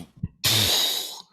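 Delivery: loud blown-out gust; imitated puff of wind. Godzilla puffing wind at them, imitated blast. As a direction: blow out hard like a huge beast. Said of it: "he puffs out".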